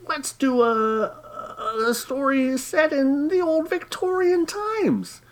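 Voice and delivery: high, slow put-on voice; mock proposal voice. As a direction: pitch the idea in a sing-song silly voice. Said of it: "and we thought, "Hey".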